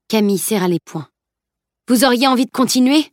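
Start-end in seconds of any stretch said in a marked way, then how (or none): 1.9-3.1 higher, sharp challenge; needling, indignant question; angry hiss. A narrator's reading. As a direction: throw the question out in anger. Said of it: "siffla-t-elle".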